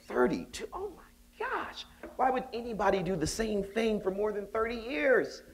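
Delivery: high-pitched incredulous gasp of disbelief; young professor silently reacting to a colleague's thirty-two years; inner disbelief. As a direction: react like it's unthinkable. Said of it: "I said it in my head".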